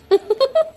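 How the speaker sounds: short high giggle; light, high-pitched laughter; amused laugh. Acted. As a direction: giggle at her story. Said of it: "உங்களுக்கு எல்லாம் சிரிப்பா இருக்கு".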